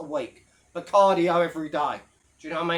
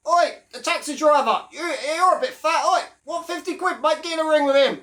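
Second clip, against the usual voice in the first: hammed-up heckle at a taxi driver; mock shouty promoter; 8 semitones higher than usual. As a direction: bark the offer across the room. Acted promoter calling out taxi driver.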